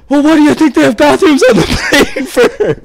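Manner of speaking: high, loud, laughing mock old man; old guy's retort. Old passenger scolding the young guy, high loud laughing mock voice. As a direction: snap back through laughter as the indignant old fella. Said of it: "the old guy's like".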